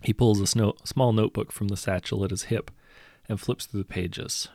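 ordinary delivery, nothing marked

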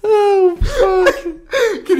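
high, loud laughing words. Real, not acted. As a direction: crack up mid-sentence. Laughing hard at the joke.

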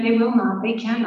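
usual speaking voice

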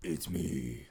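low, growled superhero voice; Batman. Acted. Guest announcing himself to the host, low Batman voice. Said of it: "featuring our first guest, Batman".

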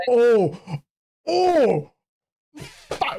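sharp, high yelps of alarm; startled cry. Real, not acted. Startled by a near accident during the podcast, sharp yelps. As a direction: yelp as if something nearly fell. Sudden startle, friend apologises.

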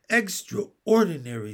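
astonished royal verdict; amazed. A narrator's reading. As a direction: marvel at what you've heard. Amazed king exclaims.

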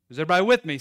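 normal talking voice

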